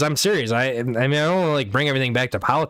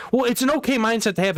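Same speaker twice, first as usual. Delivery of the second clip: higher, fast mock-casual dismissal; mocking friend's excuse; 11 semitones higher, faster than usual. Quoting his friend's excuse, higher fast mock dismissal. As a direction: toss it off quickly, pitched up.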